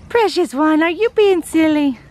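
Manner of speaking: high, playful pet-talk voice; cooing to a pet. Real, not acted. Talking to her goat.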